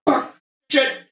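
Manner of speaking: very high-pitched squealed little yells; mock weapon yell. Player imitates a weapon yelling as it is thrown, mock yell. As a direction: yelp each word separately in a tiny high voice. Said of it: "it does yell anytime he throws it".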